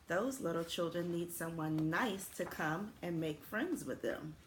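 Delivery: ordinary delivery, nothing marked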